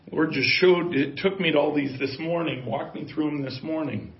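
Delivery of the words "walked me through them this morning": ordinary talk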